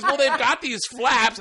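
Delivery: high, slow, laughing excuse; clueless voice. Retold or imitated. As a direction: explain it like a clueless lady. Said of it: "She goes".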